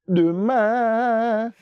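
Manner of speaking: high, loud lazy "Demain"; drawn-out tomorrow. Retold or imitated. Preacher mocks putting off salvation, a high lazy "Demain". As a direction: stretch "Demain" out lazily.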